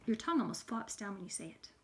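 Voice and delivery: ordinary talk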